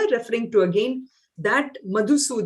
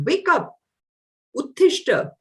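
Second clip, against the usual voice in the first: raised, higher wake-up call to a despairing friend; rousing call; 6 semitones higher than usual. Retold or imitated. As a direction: shake him awake with the command. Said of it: "He says".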